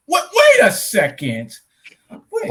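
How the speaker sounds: loud, high burst of confusion; loud, stretched double take; baffled disbelief. Real, not acted. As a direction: blurt it out, then drag the words. Disbelief at own mix-up.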